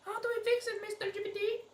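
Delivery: mock sweet falsetto. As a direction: sweet-talk the chatbot in falsetto. Mock voice to chatbot.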